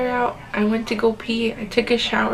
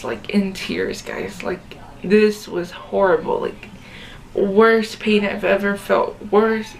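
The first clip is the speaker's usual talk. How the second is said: usual speaking voice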